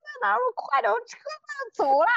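high strained yell without full volume; mock shouting for people about to miss their ride; retold urgent holler. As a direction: imitate frantic calling out, very high. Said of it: "就真的就是开始呐喊".

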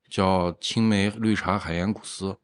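ordinary talk

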